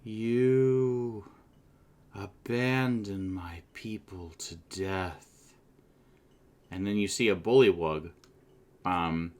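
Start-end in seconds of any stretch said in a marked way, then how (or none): 0.0-5.5 slow, grave reproach; lightly voiced lizard ghost; accusing lizardfolk revenant. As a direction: drag out the charge of betrayal. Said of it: "looks at you and says".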